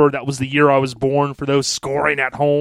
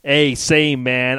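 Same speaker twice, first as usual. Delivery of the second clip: normal talking voice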